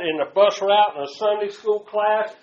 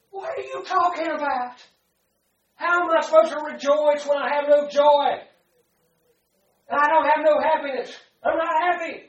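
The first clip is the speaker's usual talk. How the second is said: high, whiny complaining voice; whiny protest; 6 semitones higher than usual. Retold or imitated. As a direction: whine like a stubborn child. Still arguing with God.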